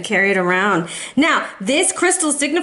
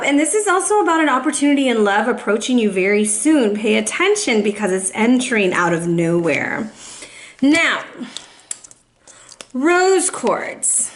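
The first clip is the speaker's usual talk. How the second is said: normal talking voice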